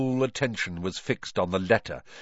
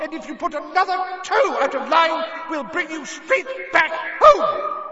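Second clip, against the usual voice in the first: much higher and louder, a shrieking furious mother; furious yells of Mrs Weasley; 6 dB louder than usual. Acted. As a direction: scream it at your son across the whole hall. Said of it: "Missus Weasley's yells".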